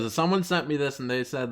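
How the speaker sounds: ordinary talk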